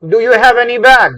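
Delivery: teacher booming the correct question; emphatic model question. Shown as a demonstration. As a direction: hammer the model question out slowly. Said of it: "प्रश्न तो पूछा जाना क्या चाहिए था".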